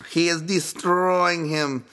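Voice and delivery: high, slow excited commentator voice; commentator call. Retold or imitated. Podcaster imitating the Russian commentator mid-fight, excited call. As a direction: stretch it out like a play-by-play caller.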